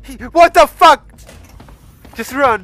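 loud, high startled shout; panicked yell. Real, not acted. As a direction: yell it in sudden fright. Monster appears suddenly.

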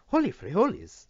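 high, loud burst of surprise; astonished exclamation. A narrator's reading. Stephen sees the boy smash the window, astonished. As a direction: blurt it out, high and amazed.

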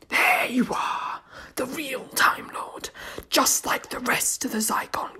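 whispered, slow alien hiss over intercom; a sour, wet whisper of a monster; sour wet creature voice. Acted.

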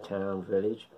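ordinary talk, nothing marked

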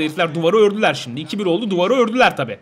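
usual speaking voice

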